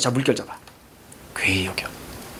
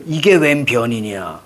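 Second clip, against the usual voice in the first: startled general; 6 semitones lower, slower than usual. Acted. Teacher voices startled general.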